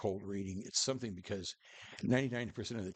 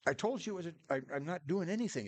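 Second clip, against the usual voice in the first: higher-pitched retold self-defence; defensive protest; 9 semitones higher than usual. Cold reader to upset best friend, defensive protest. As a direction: protest innocence, voice pushed up high.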